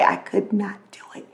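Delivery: breathy whisper, no voice at all; whispering so the sleeping house stays quiet. Real, not acted. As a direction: whisper so nobody wakes. Couldn't shout early morning.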